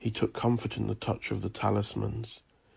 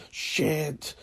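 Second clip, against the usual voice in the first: higher, hissed expletive; hissed curse; 6 semitones higher than usual. A narrator's reading. Declan fumbles the falling glass, hissing a curse. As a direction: hiss the curse as it slips. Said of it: "he hissed".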